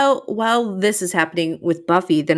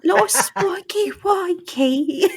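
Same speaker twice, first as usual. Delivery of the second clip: high, drawn-out vampire character voice; theatrical put-on accent, pitched way up; Drusilla impression; 13 semitones higher, much slower than usual. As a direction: draw it out high, playing an accented villainess. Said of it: "in her accent".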